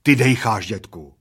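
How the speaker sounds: loud, pointed accusing repeat. A narrator's reading. Bald man confronts his cheating friend, accusing.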